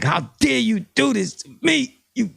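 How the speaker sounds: high, hammed-up indignant outburst; mock anger. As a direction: play Joe wounded and furious. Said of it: "coming at him with a lot of anger".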